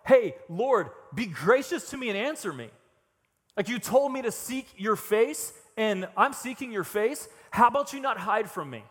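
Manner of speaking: loud, high-pitched complaint to God; indignant plea. Acted. David turns to complaining at God.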